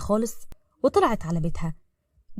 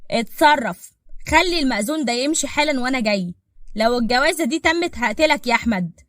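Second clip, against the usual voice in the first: loud, higher furious orders; angry, raised voice; 4 semitones higher, 5 dB louder than usual. Acted. Boss orders his bodyguard to stop the wedding, angry and loud. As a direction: bark threats down the phone. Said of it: "سالم قام بغضب وقال بصوت عالي".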